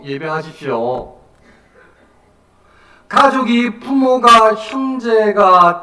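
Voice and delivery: loud, sustained sermon exhortation; full-force preaching. Real, not acted. Preacher urging the congregation to go to God, forceful exhortation. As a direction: build phrase on phrase, urging them on.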